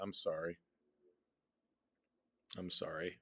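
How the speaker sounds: ordinary delivery, nothing marked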